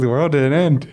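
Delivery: high, amazed disbelief at still being here; relieved wonder on waking, voice up high; surprised. Retold or imitated. Waking up after 2012 scare.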